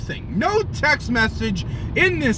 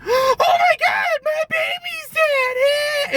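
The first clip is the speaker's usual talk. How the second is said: much higher, wailing mock cry, slow on the second half; mock hysterical mom; slower than usual. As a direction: ham up a shrieking, sobbing mother. Mock mom discovering dead baby.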